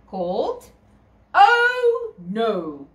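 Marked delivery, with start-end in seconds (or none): 1.3-2.9 dramatic title. Acted.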